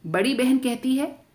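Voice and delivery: plain reading voice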